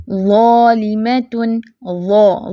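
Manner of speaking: ज़ालिमतुन slow, full thick za, thin other letters; heavy za. Shown as a demonstration. Teacher models the fat letter za, heavy slow recitation. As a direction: fill the mouth on the ज़ा of ज़ालिमतुन. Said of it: "ज़ा fat है".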